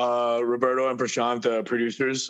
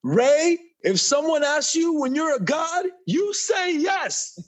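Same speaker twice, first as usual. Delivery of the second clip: movie quote shouted; slower than usual. Retold or imitated. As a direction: bellow it like a big screen hero. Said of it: "favorite line in your entire life".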